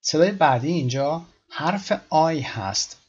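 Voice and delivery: usual speaking voice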